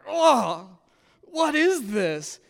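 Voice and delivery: high, slow groan of disbelief; mock outraged sleepy whine; groggy shock. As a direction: drag it out like a kid dragged from bed. Groggy kid woken at dawn.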